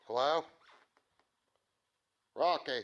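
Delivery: loud call up into the empty house; loud searching call. Real, not acted. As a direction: call out loud to find someone. Calling out through the house.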